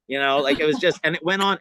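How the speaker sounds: usual speaking voice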